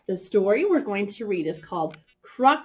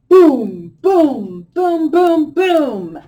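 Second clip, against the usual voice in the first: big loud booming shouts; drum-like shouted booms; 7 semitones higher, 11 dB louder, much slower than usual. Shown as a demonstration. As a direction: act out a loud animal, boom it big. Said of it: "It's a loud one".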